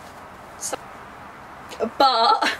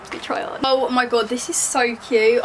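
ordinary talk

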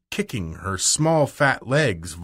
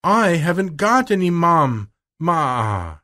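put-on high, drawn-out childish bawling; child's wail; 6 semitones higher, 3 dB louder, slower than usual. Spoiled child crying about her mother, high wail. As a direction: wail it out like a spoiled little girl.

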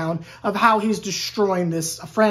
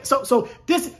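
ordinary talk

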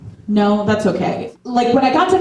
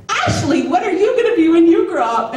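put-on high, sing-song adult-to-child question; gushing neighbor lady; 8 semitones higher than usual. New neighbor's wife asking a little girl, gushing. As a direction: imitate a grown-up cooing at a kid.

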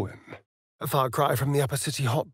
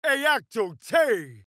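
spell incantation; 8 semitones higher, much slower than usual. Acted.

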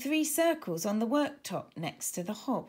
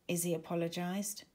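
usual speaking voice

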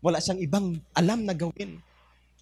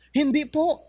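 honest woodcutter; 8 semitones higher than usual. Acted. Honest man answers the Lord.

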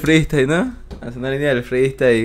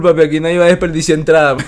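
ordinary delivery

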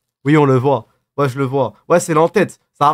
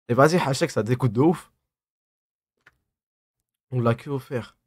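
usual speaking voice

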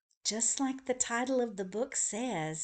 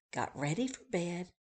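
ordinary delivery, nothing marked

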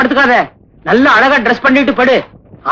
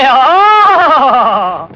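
drawn-out, falling fake sob; put-on bawl; long mock wail. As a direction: let out a hammed-up funeral howl. Mock mourning at staged death.

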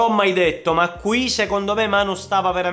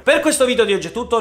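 ordinary delivery

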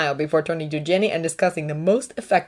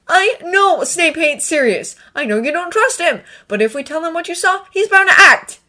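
higher, eye-rolling concession building louder; weary, raised-pitch persuasion; exasperated; 10 semitones higher, 5 dB louder than usual. A narrator's reading. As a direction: spell it out with strained patience. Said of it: "Draco rolled his eyes".

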